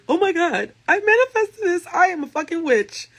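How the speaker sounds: high, loud thrilled squeal. Retold or imitated. Retelling her crush's call, squealing with delight. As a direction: squeal like the crush just called. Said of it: "I literally squealed".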